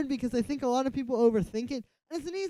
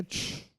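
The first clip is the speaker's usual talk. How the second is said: imitated noise sweep; 10 dB quieter than usual. Shown as a demonstration. Producer showing the effect they got, imitated sweep noise. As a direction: mimic a sweep effect with a "chhh".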